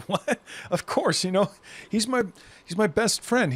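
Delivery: high, bewildered insistence on a friend; high and puzzled, defending him; confused defending. Acted. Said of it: "And you're like".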